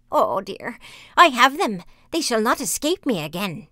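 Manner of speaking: loud, gloating witch; malicious mocking. A narrator's reading. Witch gloats as Hansel and Gretel come near, malicious mockery. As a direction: crow over captured prey with mocking relish. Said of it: "laughed maliciously and said mockingly".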